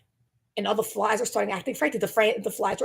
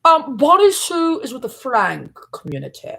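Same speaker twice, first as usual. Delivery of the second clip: character voice; 6 semitones higher, 7 dB louder, slower than usual. Acted. Character voice continued.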